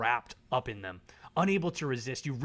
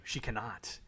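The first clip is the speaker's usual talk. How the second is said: normal talking voice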